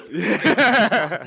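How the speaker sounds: words broken by laughter; laughing. Real, not acted. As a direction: laugh through the word. Laughing mid-line.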